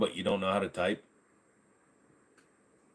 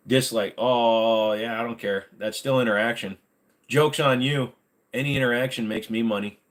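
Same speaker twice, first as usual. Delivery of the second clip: ordinary delivery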